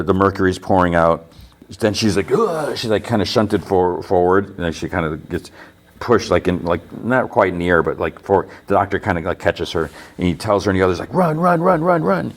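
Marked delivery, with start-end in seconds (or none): 2.3-2.7 high-pitched "ugh"; mock jolt, voice jumping up; imitated grunt of impact. Imitates being jolted forward. 11.1-12.3 high-pitched retold urging to flee; imitated panicky command, pitched well up; urgent. Doctor urges everyone to flee.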